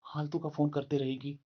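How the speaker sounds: low, soft grumble to himself; annoyed mutter. Acted. Husband ignoring his wife's repeated calls, soft irritated mutter. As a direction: mutter it under your breath, irritated.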